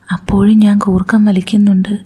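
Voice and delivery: usual speaking voice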